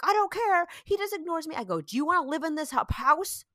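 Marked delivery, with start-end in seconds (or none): 0.0-1.5 put-on defiant child. Mom voices her son complaining about dad, defiant. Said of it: "he was like". 1.8-3.5 higher, exasperated retold scolding; losing her mind. Mom retells scolding her son, exasperated. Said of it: "I just lost my mind".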